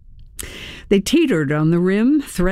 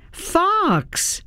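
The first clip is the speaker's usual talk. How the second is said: calling out; 13 semitones higher than usual. A narrator's reading. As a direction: call him sharply higher, without shouting. Calling the fox who ignores her.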